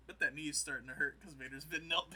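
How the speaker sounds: loud teasing line broken by laughter; laughing taunt. Retold or imitated. Retelling a taunt at a kneeling Vader, laughing loudly. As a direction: laugh through the jab at the kneeling man. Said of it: "he's like".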